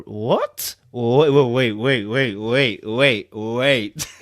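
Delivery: sudden low, loud double-take; startled wait. Real, not acted. Streamer cuts off his own reading in disbelief. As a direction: stop short in disbelief.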